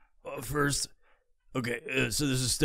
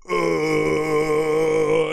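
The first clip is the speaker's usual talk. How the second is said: long, loud held moan; hammed-up drawn-out groan of pleasure; mock moan; 5 dB louder than usual. Man pleased by the video, long mock moan.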